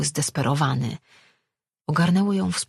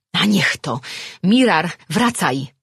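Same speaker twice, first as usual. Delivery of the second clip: loud, raised command to return; urgent call; 3 semitones higher, 5 dB louder than usual. Acted. Woman calling the other personality back, urgent shout. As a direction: call him back in exasperation.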